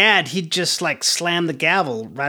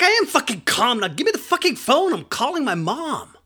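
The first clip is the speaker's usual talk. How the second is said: high-pitched angry protest; acted outburst; 8 semitones higher than usual. Teen to therapist demanding the phone, acted furious outburst. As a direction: insist hotly, pitch shooting up.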